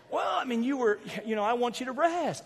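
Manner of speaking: high, soft excuse; imitated wife. Voices wife excusing herself.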